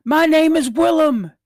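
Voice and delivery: high, desperate acted scream; Willem screaming. Brother screams at the runaway boy, high and desperate. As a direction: scream it high to make him listen. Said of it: "And he screams".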